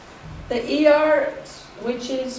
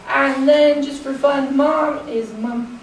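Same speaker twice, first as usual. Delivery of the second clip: normal talking voice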